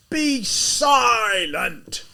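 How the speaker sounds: slow, loud, high roar; livid. Acted. Livid lieutenant roaring at the student, loud and slow. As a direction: bellow it, drawing each word out. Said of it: "This made the assistant so livid".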